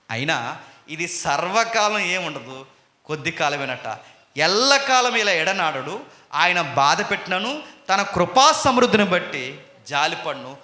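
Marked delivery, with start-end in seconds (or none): 4.4-10.6 loud, slow, higher pulpit reading; scripture proclaimed. Acted. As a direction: declaim the verse slowly.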